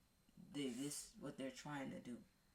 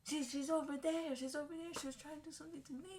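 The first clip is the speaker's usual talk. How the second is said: very high, frightened answer, speeding up at the end; frightened girl; 12 semitones higher, 4 dB louder, faster than usual. Retold or imitated. Frightened girl telling him where the witch is, very high. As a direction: point and blurt it out high and scared.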